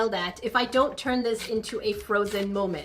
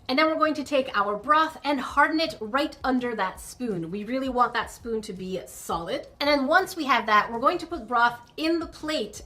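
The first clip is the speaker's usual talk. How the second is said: usual speaking voice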